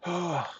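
high, falling sigh; relieved sigh. Real, not acted. Gamer after an intense round, sighing in relief. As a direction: let out a long breath.

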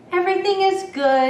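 happy. A narrator's reading. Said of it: "now he just feels so happy".

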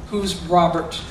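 ordinary delivery

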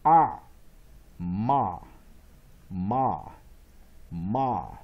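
"ah" loud, then "ma" with that same open vowel; short-O A. Shown as a demonstration. Teacher drilling variant A, loud open "ah" and "ma". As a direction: sound out "ah", carry it into each separate, firm "ma". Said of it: "variant A as short vowel O".

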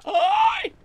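sudden shriek, very high and loud; startled scream. Real, not acted. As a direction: jump out of your skin with a sharp cry. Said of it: "qué susto". (grito de susto repentino, muy agudo y fuerte)

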